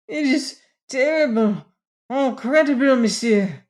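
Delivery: put-on high French-accented lament; wailing. A narrator's reading. French manager lamenting the scandal to Merkel, wailing. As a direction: wail it in dismay, word by word. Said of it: "wailed the manager".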